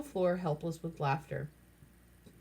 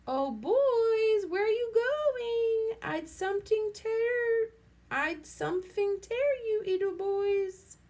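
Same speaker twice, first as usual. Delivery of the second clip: put-on very high, slow, sing-song girlish voice; slow, very high mock-girl voice calling after them; shrill girlish voice; 4 dB louder, slower than usual. A narrator's reading. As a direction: tease the boys like a prissy girl. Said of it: "shrill, girlish voice".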